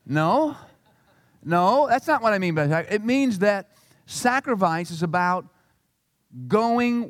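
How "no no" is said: normal talking voice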